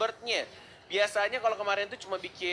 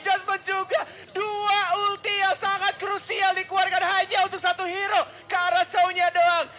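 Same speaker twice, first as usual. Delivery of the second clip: excited; 11 semitones higher, 7 dB louder than usual. Real, not acted. Caster hype on double ult.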